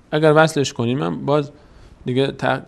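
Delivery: normal talking voice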